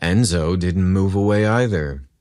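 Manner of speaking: ordinary delivery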